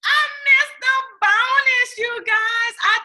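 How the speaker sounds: loud high anguished lament; anguished wail. Real, not acted. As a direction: cry it out like a heartbreak. Heartbroken wail, shout heard.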